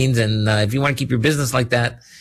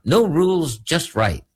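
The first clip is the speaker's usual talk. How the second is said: mock Australian accent; 7 semitones higher, slower than usual. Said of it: "that's my Australian accent".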